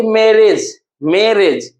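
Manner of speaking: "मैरिज" slowly, first syllable stretched; two-syllable breakdown. Shown as a demonstration. Teacher showing two syllables, "मैरिज" slow and deliberate. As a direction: draw out the start of "मैरिज".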